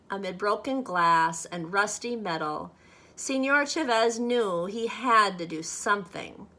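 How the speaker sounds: plain reading voice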